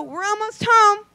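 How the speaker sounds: mock soothing. Wife coaxes drugged boyfriend back to sleep, high and sugary. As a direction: lull him back with fake sweetness. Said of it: "I'd go".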